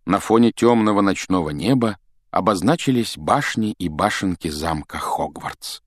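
ordinary delivery

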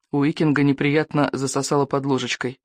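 usual speaking voice

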